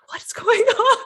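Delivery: high, laughing what's going on; laughing bewilderment. Retold or imitated. Heroine told to smile, laughing in confusion. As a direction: giggle out the question. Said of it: "And she's like".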